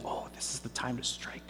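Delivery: hushed conspiratorial whisper; mother whispering her plan to trick the blind father; scheming whisper. Retold or imitated. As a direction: breathe it like a secret plot. Mother plotting against Esau.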